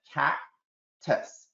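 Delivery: slow and deliberate, cactus drawn out; slow modelling voice. Shown as a demonstration. Teacher modelling blending to students, slow and deliberate. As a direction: slowly give the kids the word cactus. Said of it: "do blending first".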